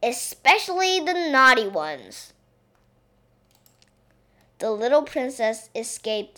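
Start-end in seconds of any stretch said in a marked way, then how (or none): 0.0-2.3 louder maid playing along; raised storybook sailor voice; hearty cry. A narrator's reading. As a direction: call it out with emphasis. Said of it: "cried the maid".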